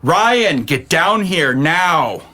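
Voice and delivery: calling out. Acted. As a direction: summon him down now. Calling again, sterner.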